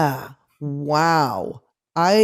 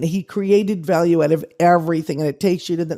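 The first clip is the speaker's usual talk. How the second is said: ordinary talk, nothing marked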